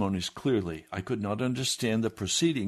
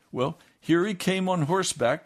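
ordinary talk, nothing marked

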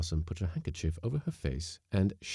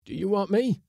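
cook voice; 14 semitones higher, 6 dB louder than usual. Acted. Cook called from bunk.

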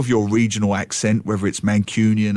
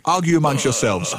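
ordinary delivery

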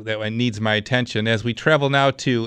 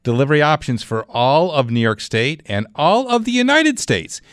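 upbeat radio ad read, pitched up; hard sell ad; 4 semitones higher than usual. Acted. Live read of a sponsor ad.

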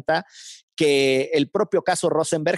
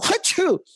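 a sharp, high imitated sneeze; dog sneeze. Telling how the dog sneezed, high mock sneeze. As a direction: burst out a high comic achoo.

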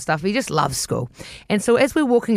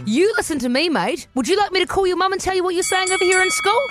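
heated telling-off; 9 semitones higher than usual. Retold or imitated. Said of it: "it was heated".

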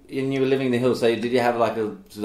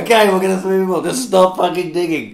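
higher, louder pleading give-in; exasperated dad; 7 semitones higher, 6 dB louder than usual. Retold or imitated. Dad giving in to mum's pool digging, loud and higher. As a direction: cave in loudly, at the end of your rope. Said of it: "he goes".

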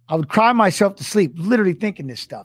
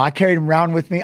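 ordinary talk, nothing marked

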